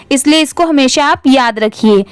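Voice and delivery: ordinary delivery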